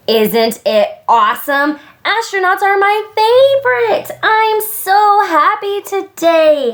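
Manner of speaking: bursting with happy excitement; excited. A narrator's reading. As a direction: gush with delight, voice shooting up. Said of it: "Hudson said excitedly".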